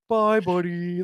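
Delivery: light imitation of the Elf narwhal; a hint of a cheery cartoon narwhal. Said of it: "sounding like the narwhal from Elf".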